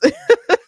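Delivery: quick high burst of laughter. Real, not acted. Laughing at the ambulance joke.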